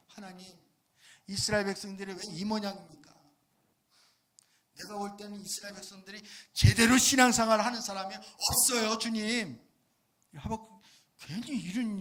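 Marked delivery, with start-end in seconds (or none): 0.1-9.6 complaining prophet. Retold or imitated. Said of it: "하박국 선지자가 하나님 앞에 그렇게 질문하는 거예요".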